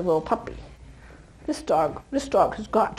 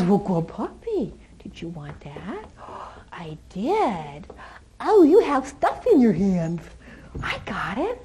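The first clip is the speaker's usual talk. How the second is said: cooing to puppy; 3 semitones lower, 3 dB quieter, slower than usual. Real, not acted.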